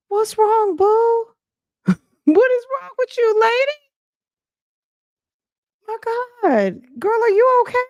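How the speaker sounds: put-on high, sing-song cooing like soothing a toddler; mock baby talk. Commenter teasing the angry arrested woman, high mock-cooing.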